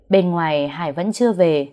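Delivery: plain reading voice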